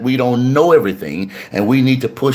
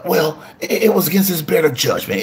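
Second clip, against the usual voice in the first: higher, hesitant voice of a woman making excuses for him; excusing girlfriend; 6 semitones higher than usual. Retold or imitated. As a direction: play the girlfriend defending her man, a bit higher. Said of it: "sometimes you'll say".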